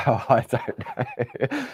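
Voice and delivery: laughing it off slowly. Real, not acted. Presenter giving up on saying clematis, laughing. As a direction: chuckle and give up.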